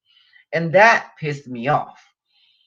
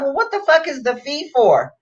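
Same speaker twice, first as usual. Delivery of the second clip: indignant retort; 7 semitones higher than usual. Retold or imitated. Angry retort to airline agent.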